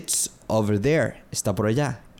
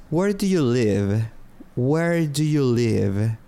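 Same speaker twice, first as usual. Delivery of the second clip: slow, careful "Where do you live?"; slow teaching model; slower than usual. Shown as a demonstration. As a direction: say "Where do you live?" slowly for learners to copy. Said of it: "repeated model question".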